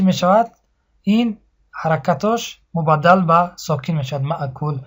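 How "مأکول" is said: ordinary talk, nothing marked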